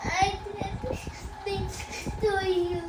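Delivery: small, high, soft and tearful; tearful apology. Real, not acted. Child apologises to Papa, tearful.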